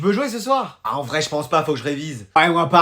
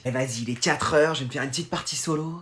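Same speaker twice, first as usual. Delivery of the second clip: lower, much softer murmur; hushed; 8 semitones lower, 13 dB quieter than usual. Acted. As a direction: keep it down so nobody hears. Gamer alone, quiet.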